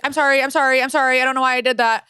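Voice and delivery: a frantic friend saying sorry; frantic apology. Retold or imitated. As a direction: gush apologies like a panicked friend. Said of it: "And she's like".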